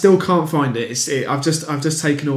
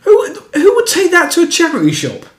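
incredulous; 14 semitones higher, 6 dB louder than usual. Real, not acted. Incredulous question about the comment.

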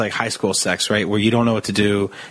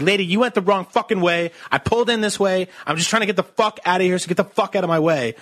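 higher-pitched mock rant, no real shout; retold angry shouting; 6 semitones higher than usual. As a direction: act out arguing back, indignant. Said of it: "So I just get really mad".

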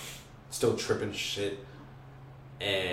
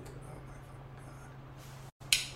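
slow, soft whisper; whispered as a cop car creeps by; whispered dread; 14 dB quieter, much slower than usual. Retold or imitated. Awed whisper while driving.

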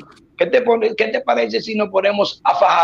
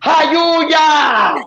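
high excited cry; shout; 7 semitones higher than usual. Retold or imitated. She starts yelling, a high shouted cry. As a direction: shout the word out. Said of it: "La ponete a gritar".

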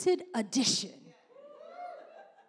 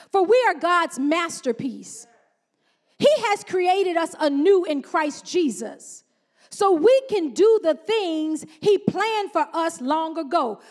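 slow, measured Bible reading; scripture reading; 5 dB louder, slower than usual. Acted. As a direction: read the verse deliberately to the church.

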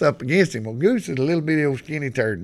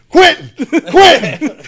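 high, loud yell for a player; hollered call; 13 semitones higher, 12 dB louder, slower than usual. Retold or imitated. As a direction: shout the name across the field. Retold coach calling in a player.

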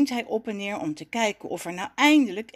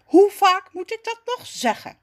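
exasperated, raised high voice; annoyed; 10 semitones higher, 7 dB louder than usual. A narrator's reading. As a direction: complain loudly, fed up with repeating yourself. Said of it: "zegt neef geërgerd". (geërgerd klagen, met stemverheffing)

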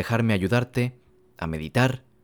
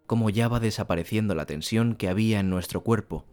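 guided meditation. Acted. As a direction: ease the listener into stillness.

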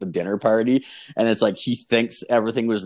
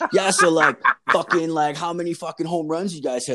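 laughing taunt; 7 semitones higher, 5 dB louder than usual. Real, not acted. Drunk, taunting the baseball guys.

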